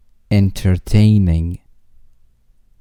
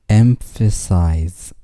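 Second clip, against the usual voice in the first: slow model; slower than usual. Shown as a demonstration. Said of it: "vocabulary drill, word repeated".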